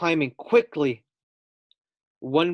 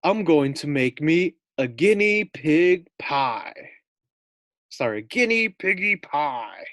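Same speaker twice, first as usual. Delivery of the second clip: slow, louder giant voice; gloating giant; 2 semitones higher, 4 dB louder than usual. Acted. Giant gloating to Fluffy about his pie. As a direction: savour each word like a hungry giant.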